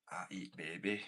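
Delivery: mock Scottish accent; comic put-on Scottish brogue. Shown as a demonstration. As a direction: do a jokey Scottish film villain. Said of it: "of a Scottish accent".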